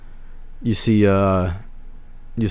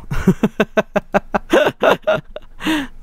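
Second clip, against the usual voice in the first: high laugh; 13 semitones higher than usual. Real, not acted. Laughs at fan art.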